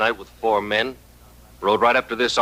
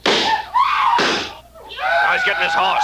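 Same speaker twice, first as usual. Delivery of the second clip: alarmed shout; much slower than usual. Acted. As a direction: shout the warning.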